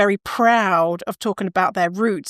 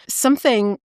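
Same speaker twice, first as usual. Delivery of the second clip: normal talking voice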